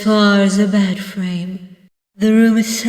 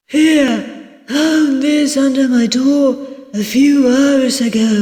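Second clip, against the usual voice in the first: higher-pitched innkeeper character voice; helpful innkeeper; 6 semitones higher, 3 dB louder than usual. Acted. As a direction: hand it over in a raised host voice. Voices innkeeper Barrow in a story.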